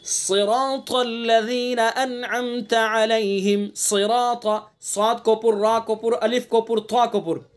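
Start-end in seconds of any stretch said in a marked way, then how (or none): 0.0-4.4 slow melodic tajweed chant; Quran recitation. Shown as a demonstration. Teacher reciting the verse slowly for students. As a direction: draw each syllable out in recitation.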